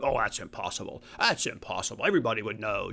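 low, dragging mock voice of a doubter; mock dismissive believer.

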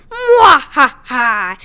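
loud, slow villain cackle; gloating evil cackle; evil laugh. Acted.

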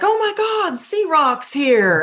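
high-pitched excited gush; retold starstruck excitement. Spotting an Instagram acquaintance in person, retold excitement. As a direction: re-enact an excited squeal of recognition.

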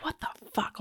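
high breathy whisper of shock; stunned inner thought, whispered; whispered disbelief. Retold or imitated. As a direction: hiss it under your breath in disbelief.

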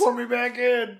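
high, loud pleading. Retold or imitated. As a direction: beg to be sent back. Begging as the time traveller.

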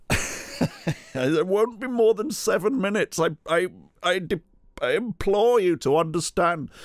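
high, frantic, overly formal pleading; retold frantic pleading. Trapped man begging the job centre by phone, high frantic plea.

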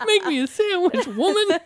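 put-on high, laughing child demand; bossy toddler voice. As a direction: boss them like a tiny tyrant.

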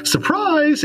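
very high, playful "Surprise!"; gleeful ambush cry. Acted. Game master voicing Elsa pouncing on her victim, high and gleeful. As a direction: leap out with a shrill-pitched surprise. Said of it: "you jump out and go".

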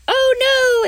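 high, loud panicky cry; panicked oh no. Retold or imitated. Animals crying out as a hundred bees buzz out, panicked high cry. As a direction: shriek it in alarm.

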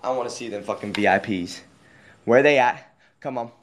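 normal talking voice

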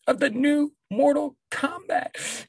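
high, slow, drawn-out hype; hype announcer. Acted. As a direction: announce it like a trailer. Hyping the new movie.

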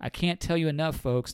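usual speaking voice

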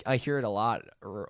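ordinary delivery

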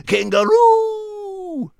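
very high, very slow drawn-out "Kangaroo!"; mocking the name. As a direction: stretch out "Kangaroo!" high and silly. Mocking the funny animal name.

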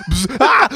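spray hiss into a high yelp; jokey re-enacted startled scream; mock spray and scream. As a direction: imitate a spray can, then yelp. Re-enacting the spray-can stunt.

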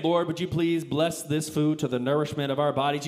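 a mock solemn blessing; mock pious prayer. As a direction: pray it like a solemn grace. Mock prayer over junk food.

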